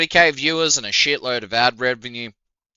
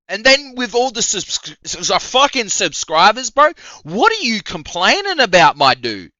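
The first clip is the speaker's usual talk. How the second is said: ordinary talk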